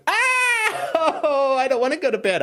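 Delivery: put-on high, crying kid's protest; whining child. Host playing a child sent to bed, high crying whine. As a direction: wail against bedtime. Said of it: "the mind of a child".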